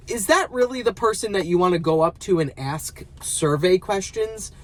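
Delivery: ordinary delivery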